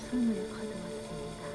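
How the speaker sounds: usual speaking voice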